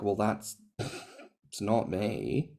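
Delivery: normal talking voice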